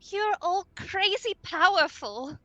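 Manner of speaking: panting, high and loud with amazement; breathless awe. Acted. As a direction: gasp it out in wonder. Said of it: "Minerva is just, like, panting".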